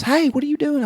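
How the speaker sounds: high-pitched mock calling-out; imitated interrupting companion. Retold companion loudly interrupts the chase.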